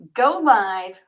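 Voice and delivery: ordinary delivery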